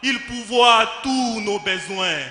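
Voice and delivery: loud, emphatic preaching declamation; fervent proclamation. Acted. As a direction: proclaim it forcefully to the congregation. Proclaims God as orphans' father. (proclamation fervente, d'une voix forte)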